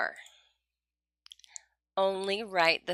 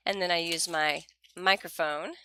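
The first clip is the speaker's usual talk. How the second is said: ordinary delivery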